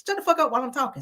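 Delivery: fast, high-pitched mock snap; retold snapping. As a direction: imitate someone angrily cutting a friend off. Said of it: "So he snaps on him".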